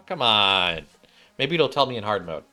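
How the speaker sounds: ordinary talk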